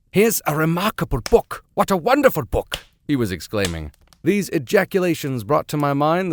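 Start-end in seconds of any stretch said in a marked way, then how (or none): 0.1-2.8 put-on excited old German professor, high and loud; booming German-accented delight. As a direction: exclaim like a wiry old German scholar. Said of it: "German accent".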